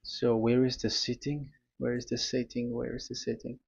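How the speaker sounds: soft, fading self-talk while searching; talking to oneself. Real, not acted. Searching for screen setting, to himself.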